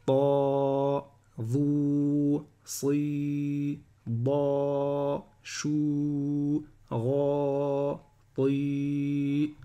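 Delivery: every syllable from TA to TI held long on a monotone; long vowels. Shown as a demonstration. Teacher drilling the line of long vowels, held long. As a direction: chant "ta", "vous", "si" and the rest with stretched level vowels. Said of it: "on passe aux voyelles longues".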